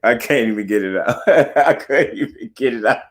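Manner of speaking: real laugh breaking into the words; tongue-tied laughter. Real, not acted. Host tongue-tied on his own point, laughing. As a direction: crack up at your own stumbling. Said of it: "I'm tongue-tied, man".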